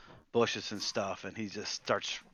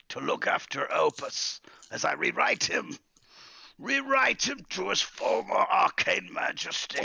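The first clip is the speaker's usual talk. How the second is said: irate wizard; 9 semitones higher than usual. Acted. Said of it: "He's like".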